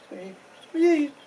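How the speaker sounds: high squeaky squeal imitating hides. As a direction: squeak it out high like creaking leather. Hides squeaking under foot.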